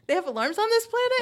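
high, loud incredulous question; mock astonishment. Co-host voicing Quinn startled by alarms, hammed-up surprise. Joins in acting the startled character.

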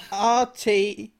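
slow, deliberate letter-by-letter spelling; spelled out slowly. Shown as a demonstration. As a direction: spell each letter out carefully. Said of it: "How do you spell that".

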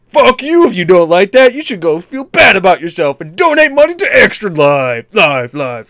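high, booming announcer hype; hammy Sunday Sunday Sunday promo; monster truck ad. Pretend. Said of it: "shock jock kind of a thing".

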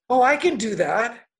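breezy quote. Retold or imitated. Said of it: "And Eve goes".

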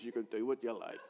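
very low, quiet aside; muttered dismissal. Retold or imitated. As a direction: drop the voice and brush it off. Acts his dismissive reply.

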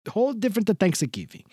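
put-on crooning old Italian charmer, laying it on thick; Mister Del Rossi. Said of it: "I gotta get the old man in here".

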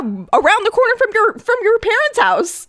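blurting it out, eager to prove the point; excited. Real, not acted. To her co-host about Michael getting pulled over, excited and insistent.

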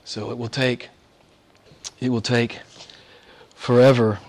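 ordinary delivery

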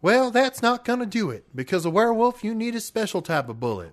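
high folksy pitchman; hammed-up folksy ad voice. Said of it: "sent us a recording".

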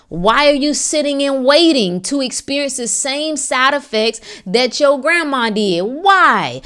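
high, forceful rhetorical questioning; impassioned challenge. Real, not acted. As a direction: demand answers like a fired-up preacher.

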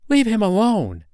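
the mother duck shouting at the duck biting her son; protective cry. Acted. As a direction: snap at the bully to stop. Mother defends duckling.

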